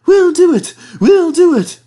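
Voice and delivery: high, loud determined cry; brave panting. Acted. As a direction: push on bravely, puffing hard. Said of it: "panted Thomas bravely".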